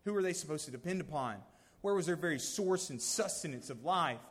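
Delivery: ordinary talk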